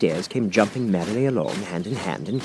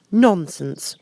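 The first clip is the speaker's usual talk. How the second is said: firm and a little louder; decided Alice; 10 semitones higher, 4 dB louder than usual. A narrator's reading. Alice defying the Queen, firm and decided. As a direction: plant your feet and refuse. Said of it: "very loudly and decidedly".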